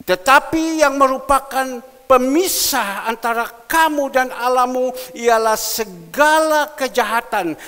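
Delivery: loud, high scripture reading, slowed at points; booming pulpit reading; preaching at full force. Acted. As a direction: proclaim the verse to the whole church.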